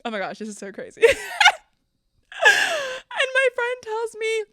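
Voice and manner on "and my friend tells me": slow, high, loud squeal of amusement; laughing while talking. Real, not acted. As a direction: squeeze the words out through laughter. Cracking up at own retelling.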